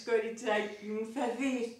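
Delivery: usual speaking voice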